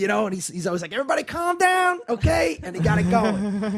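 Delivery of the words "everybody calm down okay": high-pitched peacemaker imitation; Rodney Perry's positive energy. Host calming an arguing crowd, high and upbeat. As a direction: lift the voice high to settle everyone. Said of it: "he just brings his positive energy".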